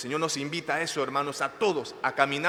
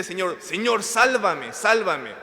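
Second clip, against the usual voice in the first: raised, high urgent plea; crying out to God; 4 semitones higher, 6 dB louder, slower than usual. Shown as a demonstration. Preacher showing the congregation how to cry to the Lord, shouted plea. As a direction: shout it as a call for rescue. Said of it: "nos invita hoy a gritarle".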